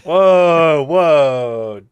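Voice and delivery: loud offended whoa; shocked whoa. Real, not acted. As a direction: recoil loudly, stung. Reacting to being insulted.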